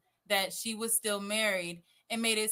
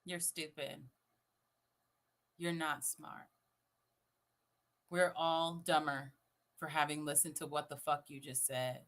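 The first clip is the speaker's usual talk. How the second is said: low, soft, unhurried put-down; quiet, deadpan dressing-down; deadpan mock insult; 10 semitones lower, 7 dB quieter than usual. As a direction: deliver the verdict low and dry. Deadpan echo of played clip.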